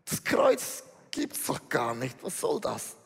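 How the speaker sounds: low, dismissive mocker's scoff; verächtlich. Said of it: "schüttelt verächtlich den Kopf und sagt". (tiefe, verächtlich abwinkende Stimme)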